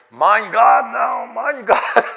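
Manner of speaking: loud, high, drawn-out wail; hammed-up ecstatic exclamation; ecstatic woman's cry. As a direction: imitate a revival-meeting woman crying out. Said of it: "this one woman grabbed him".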